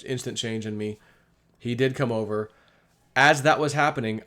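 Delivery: normal talking voice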